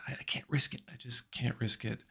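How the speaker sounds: soft, resigned retold voice; hushed admission. Joe explains he can't risk sponsors, softly. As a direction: admit it quietly, almost under the breath.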